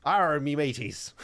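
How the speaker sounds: slow, drawn-out pirate growl. Shown as a demonstration. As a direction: do a hammy pirate. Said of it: "I can do pirate".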